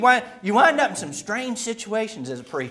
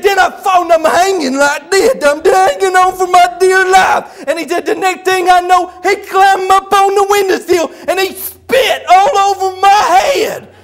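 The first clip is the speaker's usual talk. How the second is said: high, loud frantic mock complaint; hammed-up worked-up shouting, tongue-tied; Buddy; 8 semitones higher, 10 dB louder than usual. Buddy recounts the husband's attack to the preacher, shouting agitatedly. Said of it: "when he gets real worked up".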